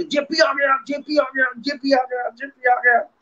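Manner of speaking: high, repeated excited calling; mock excited shout. Director greets the actor with an excited high call. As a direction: call out the name like you can't contain it. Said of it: "चिल्लाने लगे".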